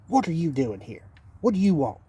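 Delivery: mildly rude, imitating an impatient manager. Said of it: "In kind of a rude manner".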